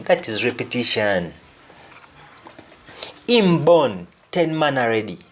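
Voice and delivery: usual speaking voice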